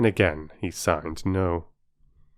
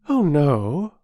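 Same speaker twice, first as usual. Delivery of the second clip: put-on higher girlish reply; young Bobby, brave; 8 semitones higher, 5 dB louder than usual. Bobby telling the station master she is not afraid, higher girlish voice. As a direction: brave little denial.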